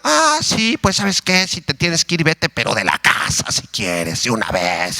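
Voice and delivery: retorting wife. Acted. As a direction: play a wife firing back at her husband. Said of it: "Y la señora se desquita".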